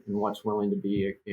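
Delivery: ordinary delivery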